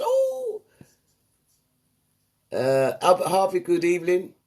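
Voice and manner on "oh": a sudden very high laughing oh; laughing exclamation. Real, not acted. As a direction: burst out laughing. Host reacts with a laugh.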